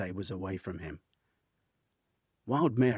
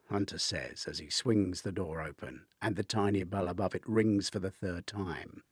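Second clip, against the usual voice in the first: plain reading voice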